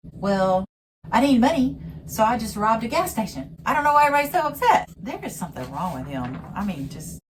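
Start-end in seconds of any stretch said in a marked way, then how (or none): 0.1-4.9 high, loud whiny mock voice, rushing at the end; sarcastic high-pitched impression of a self-pitying man; mocking Cody. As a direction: squeal it out like a clueless man-child. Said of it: "it's almost like he's saying".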